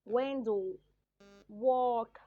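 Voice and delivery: "window", "walk" said loudly, one at a time with a clear W; example words for W. Shown as a demonstration. Teacher showing students the W sound, loud, separate example words. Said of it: "When I say".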